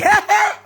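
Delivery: high loud burst of laughter; shrill laugh. Acted. Mocking the office call, a shrill high laugh. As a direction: cackle sharply high.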